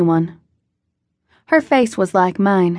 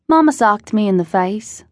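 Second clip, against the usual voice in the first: ordinary delivery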